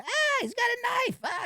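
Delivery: high-pitched imitated panic scream; retold scream of alarm. Bouncer retelling a cry heard through the window, mock panic scream. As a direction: mimic someone shrieking out a warning.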